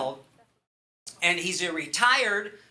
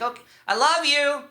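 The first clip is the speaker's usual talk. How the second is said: higher, louder blurt; slipping it in nervously; 6 semitones higher, 4 dB louder than usual. Retold or imitated. Son slipping it in as the call ends, nervous blurt. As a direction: blurt it out on worked-up courage. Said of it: "I worked up the courage".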